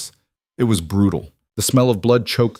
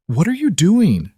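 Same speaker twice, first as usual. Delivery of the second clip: high, urgent challenge; alarmed demand; 10 semitones higher, 4 dB louder than usual. A narrator's reading. Healer grabs her arm to stop her, alarmed demand. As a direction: pitch the question far up, aghast.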